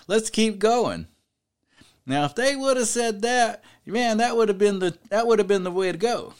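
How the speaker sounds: rousing preacher, pitch climbing as it goes; fervent preaching. Real, not acted. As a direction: preach it with growing conviction. Preaches with rising fervor.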